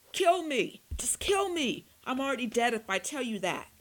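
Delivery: high, loud pleading scream; desperate shout. A narrator's reading. Doomed vampire screams at his captors to kill him, loud high desperate scream. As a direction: beg them to end it, at the top of his voice. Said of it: "Tim shouted".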